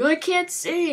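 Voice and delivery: high-pitched, hammed-up wail; play-acted frantic cry; mock panic. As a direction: imitate a man panicking, voice shooting way up. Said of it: "he's going crazy".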